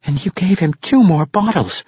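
high-pitched indignant protest. A narrator's reading. Young halfling accusing the barkeep, protesting. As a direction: object in a high youthful voice. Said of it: "Regis protested".

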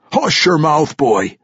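loud, high gruff scold. Acted. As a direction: scold the young one sharply. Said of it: "Ragged Dane scolded".

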